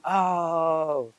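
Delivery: high, drawn-out mock "oh"; imitated amazed reaction. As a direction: mimic a crowd delighted and astonished. Imitates friends reacting to his accent.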